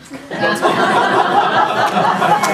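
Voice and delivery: high breathy burst of laughter; giggling laugh. Real, not acted. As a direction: laugh out loud at the memory. Laughing at the prank.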